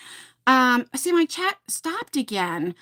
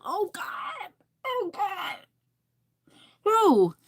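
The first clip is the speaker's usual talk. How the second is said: pained groan; 7 semitones higher, 3 dB quieter, much slower than usual. Real, not acted. Reacting to lava soap story.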